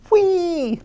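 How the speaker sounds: very high, sustained whee; gleeful whee. Acted. Monkey swinging from a vine, high gleeful whee. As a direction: sing out the swing like a thrilled monkey.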